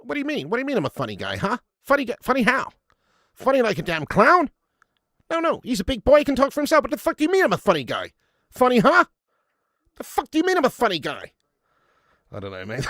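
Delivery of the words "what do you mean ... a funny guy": high-pitched, touchy gangster; Joe Pesci. Acted. Streamer plays the gangster asking how he's funny, high-pitched aggression. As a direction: snap back high and loud, spoiling for a fight. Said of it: "you have to be quite high-pitched to do him".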